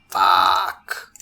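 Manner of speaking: long, drawn-out low swear; dismayed curse. Real, not acted. Player sees his plans are stuffed, dismayed drawn-out curse. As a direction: let the curse drag out in dismay. Realises the fire is coming.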